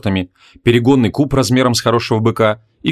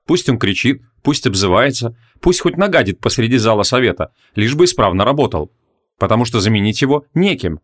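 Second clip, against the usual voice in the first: ordinary delivery, nothing marked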